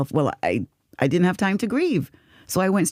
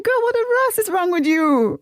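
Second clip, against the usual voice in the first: high, exasperated mock telling-off; self-scolding; 12 semitones higher than usual. Teller recalls scolding herself over the crying, exasperated mock scolding. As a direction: tell yourself off, pitched way up.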